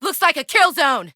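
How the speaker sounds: usual speaking voice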